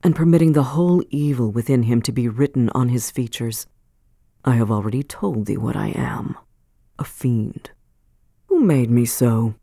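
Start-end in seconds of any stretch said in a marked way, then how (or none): 4.4-9.5 put-on sinister old man. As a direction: speak low like a bitter fiend. Chillingworth names himself a fiend.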